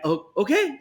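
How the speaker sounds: high, surprised little okay; meek surprise. Retold or imitated. Guest answering Seth MacFarlane, high surprised okay. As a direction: caught off guard, go up high. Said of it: "And I".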